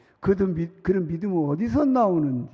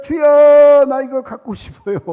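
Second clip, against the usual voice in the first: crying out to God, much higher and louder; loud pleading prayer; 10 semitones higher, 18 dB louder than usual. Pretend. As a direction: beg at full voice like a bold child. Pastor acts out bold prayer.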